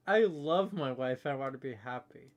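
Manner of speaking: ordinary talk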